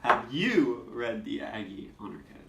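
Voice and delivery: single out "you"; stress on "you". Shown as a demonstration. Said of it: "different inflection on the words".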